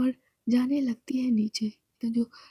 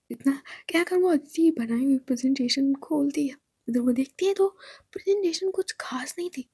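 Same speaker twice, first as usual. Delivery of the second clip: ordinary delivery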